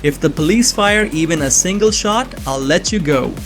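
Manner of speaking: ordinary delivery, nothing marked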